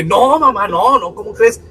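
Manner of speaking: high, loud panicked protest; flustered denial. Retold or imitated. Son protesting to his mother, high and flustered.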